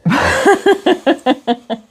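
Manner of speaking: high, loud laugh; high burst of laughter. Real, not acted. Laughs at the joke.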